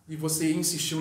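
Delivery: usual speaking voice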